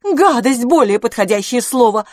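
high, loud, indignant grumble; grumbling. A narrator's reading. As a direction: grumble forcefully in agreement. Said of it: "проворчала мисс Дюбари". (громкое возмущённое ворчание)

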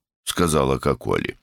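ordinary delivery

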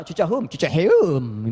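slow Sundanese "Cicaheum" with the eu vowel; Sundanese place name. Shown as a demonstration. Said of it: "ke Bandung".

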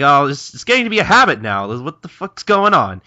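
high, loud mock complaint; acted exasperation. Kano fed up with being called gorilla, exasperated. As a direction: push the pitch up in comic exasperation.

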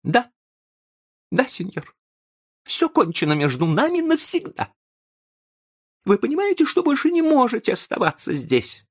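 very high, overwrought voice; agitated insistence. A narrator's reading. Young man breaks with the professor for good, agitated. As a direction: insist on it, pitched right up.

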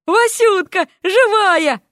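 high, delighted exclamation; elated, high-pitched welcome; overjoyed. A narrator's reading. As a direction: cry out in joy at finding a friend. Overjoyed reunion with lost friend.

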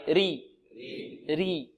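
"ري" with a thin, unemphatic r; light ر with kasra. Shown as a demonstration. Teacher leading students to repeat after him, light ر drill. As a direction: say "ري" slowly with a light ر. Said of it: "انطقوا معي".

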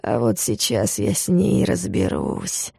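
low, slow and quiet menace; angry through teeth. A narrator's reading. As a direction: grit it out threateningly. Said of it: "зло процедил Витя сквозь зубы".

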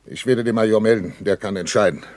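calming. Acted. Bratke calms the shaken Friesenhahn, low and reassuring. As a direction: settle him down gently. Captain calms panicked comrade.

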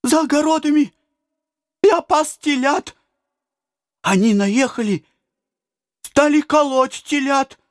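put-on high, breathless child blurting out horror; panicked child voice; frightened boy. A narrator's reading. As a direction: rush it out like a scared shepherd boy. Terrified boy tells of raid.

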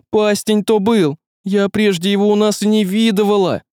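high-pitched tearful babble; barely holding back tears. Acted. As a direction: let the words spill out on the edge of crying. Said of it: "Она бормотала, едва сдерживая слёзы".

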